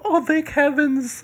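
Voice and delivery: put-on slow, high, relieved old lady. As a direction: sigh it out like a grateful granny. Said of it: "She's like".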